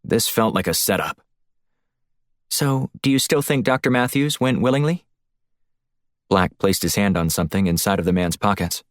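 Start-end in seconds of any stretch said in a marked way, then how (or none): 2.5-5.0 voiced woman, pitched higher; light female handler voice, concerned; Shields on comms.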